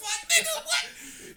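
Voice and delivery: high, loud laughing what; laughing disbelief. Real, not acted. Reacting in disbelief.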